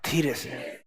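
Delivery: low, near-silent धीरे से; very soft. Shown as a demonstration. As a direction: breathe धीरे से out gently. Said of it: "जोर से नहीं, धीरे से".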